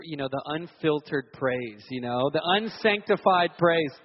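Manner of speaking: ordinary talk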